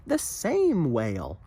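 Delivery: plain reading voice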